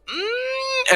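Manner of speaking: high whimpering mm; a little sad whine; mock pouty hum. Singer reacting to never playing West Palm, whimpering hum. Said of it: "To which she's like".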